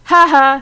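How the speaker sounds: bright spoken ha ha laugh. A narrator's reading. Sayaka laughs at Madoka's dream story, a loud mock laugh. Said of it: "Sayaka laughed".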